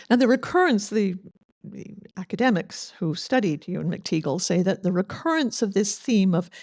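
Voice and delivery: ordinary talk, nothing marked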